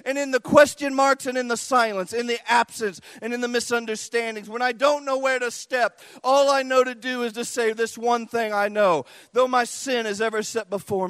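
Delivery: loud, high, driving sermon climax; preacher pouring it out to the congregation; full-force preaching. Acted. Preacher crying out to God before the church, loud and high.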